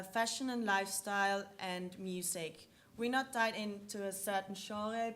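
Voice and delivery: normal talking voice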